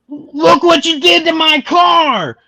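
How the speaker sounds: high, loud, drawn-out outraged yell; hammed-up high-pitched rage; angry shout. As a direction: bellow it as a furious driver. Acted angry driver in the story.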